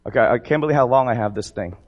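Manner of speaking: ordinary talk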